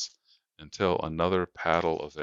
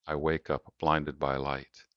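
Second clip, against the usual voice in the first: plain reading voice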